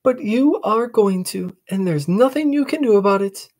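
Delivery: bouncy teasing sister; little sister calling out; cheeky little sister. Acted. Sister teases.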